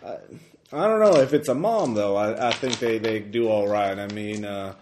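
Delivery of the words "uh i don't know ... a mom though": small-town local, high and loud; a friendly local chatting; lightly voiced small-town old-timer; folksy townsman. GM voicing townsfolk NPC.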